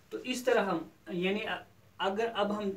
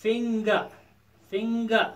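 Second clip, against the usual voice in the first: "Finger" as fing-ga, higher, both halves distinct; syllable by syllable; 4 semitones higher, 4 dB louder, much slower than usual. Shown as a demonstration. Teacher explaining the written gap, "finger" in two separate syllables. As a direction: sound out "finger" in two clear beats. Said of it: "توے تھوٚو مےٚ یَتھ".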